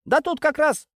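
flustered eager. Acted. Sergeant-major fussing to help the major, flustered eager. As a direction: fuss to be helpful. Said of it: "засуетился старшина, от старания заговорил сбивчиво".

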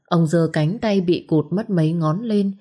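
ordinary delivery

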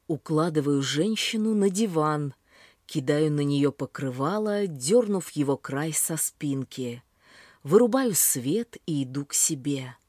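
ordinary delivery

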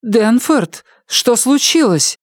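a meek woman asking in fear; frightened high woman's voice; timid frightened wife. A narrator's reading.

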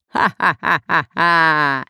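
slow hoarse villain laugh; loud, drawn-out wicked cackle; nasty hoarse laugh. A narrator's reading. Pirate gloats over his captives, nasty hoarse laughter. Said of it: "засмеялся противным хриплым голосом".

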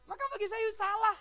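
normal talking voice

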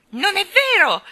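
put-on high, loud childish squeal; shrieked denial. A narrator's reading. Colin denies being selfish, shrieking. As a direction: scream it back, outraged. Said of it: "strillò Colin".

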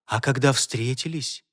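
soft, low murmur; whispering. A narrator's reading. Enchantress reproaching Holger at their meeting, whispering. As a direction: murmur it close and softly. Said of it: "шептала она".

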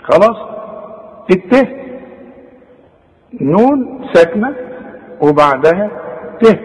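ordinary delivery

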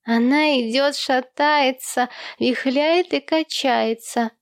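put-on high, slow little-boy chant; child echoing father. A narrator's reading. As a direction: pipe up and sing-song it like a child. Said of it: "вторил отцу Томми".

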